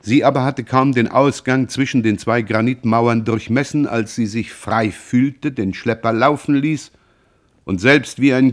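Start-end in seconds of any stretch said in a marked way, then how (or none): none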